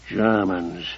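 low hissing whisper; hissed. A narrator's reading. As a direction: hiss it under your breath. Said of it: "hissed Ruddy".

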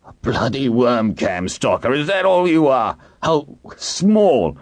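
high, strained angry growl; scornful growl climbing in pitch; growled contempt. A narrator's reading. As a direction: sneer with rising outrage. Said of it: "Hiram growled".

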